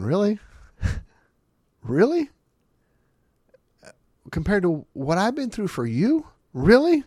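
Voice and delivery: high, loud incredulous Really; God raising his eyebrows at a complainer. Retold or imitated. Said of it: "God just raises his eyebrows going".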